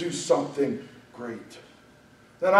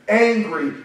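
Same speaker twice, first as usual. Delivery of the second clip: ordinary delivery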